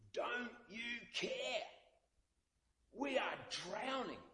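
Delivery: high, slow, desperate plea from the boat; panicked cry. Acted. Disciples shouting at sleeping Jesus, high and panicked.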